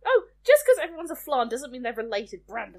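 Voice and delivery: loud teasing accusation; mock outrage. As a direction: call him out in fake offense.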